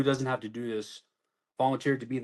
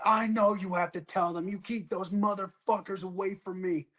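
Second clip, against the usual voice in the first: higher, raised angry insistence; retold anger; 8 semitones higher than usual. Soldier telling MP to keep leadership away, angry. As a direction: demand they keep away. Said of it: "I'm like".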